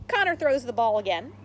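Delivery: ordinary delivery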